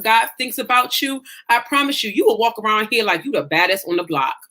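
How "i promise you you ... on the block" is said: ordinary talk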